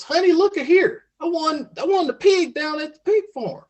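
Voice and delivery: high-pitched, hammed-up country husband; excited farmer. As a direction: call to the wife with a folksy prize-winner's pride. Farmer proudly announces his prize.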